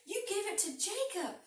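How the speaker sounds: a wronged son demanding his share; angry demand. Acted. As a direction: Esau demanding something from his father, upset. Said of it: "And Esau was angry".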